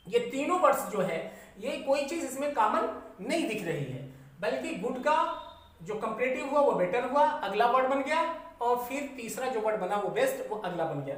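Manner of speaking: normal talking voice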